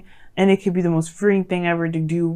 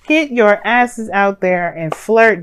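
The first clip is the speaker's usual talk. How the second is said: slow, word-by-word playful order; deliberate, drawn-out egging on; slow emphatic urging; 2 semitones higher, 4 dB louder, slower than usual. Real, not acted. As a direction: spell it out firmly, one word at a time.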